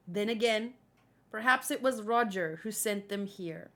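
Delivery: ordinary talk, nothing marked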